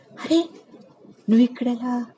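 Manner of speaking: high, excited cry of surprise; startled joy at seeing an old friend; nearly shouting in surprise. A narrator's reading. Varshini spots Krishna Nair at the festival, nearly shouting. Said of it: "గట్టిగా అరిచినంత పనిచేసింది".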